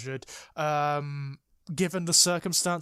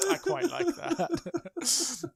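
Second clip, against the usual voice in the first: drawn out, much higher through a laugh; amused laughing; 13 semitones higher, much slower than usual. Real, not acted. To co-host, enjoying the pun, laughing. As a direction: savour it while laughing.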